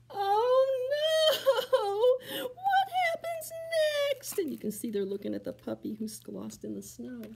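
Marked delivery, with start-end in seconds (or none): 0.1-4.4 very high crying cry of worry; hammed-up sobbing. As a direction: wail anxiously for the next page. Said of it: "They sob and they cry".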